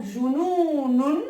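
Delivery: jununun, the long u drawn out; long vowel shown; slow model word. Shown as a demonstration. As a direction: stretch the u in jununun. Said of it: "on voit une voyelle longue".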